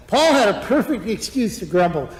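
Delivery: ordinary talk, nothing marked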